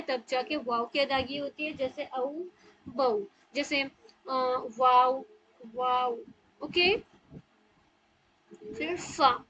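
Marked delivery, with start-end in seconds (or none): 1.7-6.2 औ बौ वाव with the lips rounded into a circle; waw demo. Shown as a demonstration. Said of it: "पूरी circular shape की बनती है". (होंठ गोल करके वाव)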